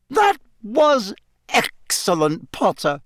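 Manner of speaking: high, slow, emphatic praise; crying out praise. A narrator's reading. Professor praising Potter as he lands, crying out. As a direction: exclaim it high, word by word. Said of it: "cried Professor McGonagall".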